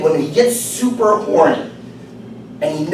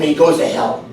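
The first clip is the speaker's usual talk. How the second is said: ordinary delivery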